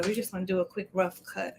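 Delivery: ordinary talk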